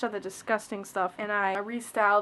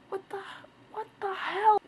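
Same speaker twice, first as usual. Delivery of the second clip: much higher, rising disbelief; shocked outrage; 9 semitones higher than usual. Real, not acted. Spotting another botched part of her hair, much higher and shocked. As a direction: catch your breath, then blurt it.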